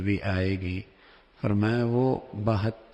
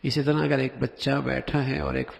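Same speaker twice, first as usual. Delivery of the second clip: ordinary delivery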